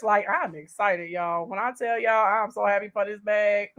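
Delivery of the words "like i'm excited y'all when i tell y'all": normal talking voice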